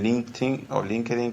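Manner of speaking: first LinkedIn as English "LINK-in", second LinkedIn as Spanish "lin-ke-DIN"; two pronunciations. Shown as a demonstration. Guest recalling his English joke, LinkedIn pronounced both ways. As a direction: say LinkedIn English-style, then correct to three-syllable Spanish LinkedIn.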